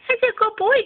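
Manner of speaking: high squeaky pet praise; talking to a dog. Real, not acted.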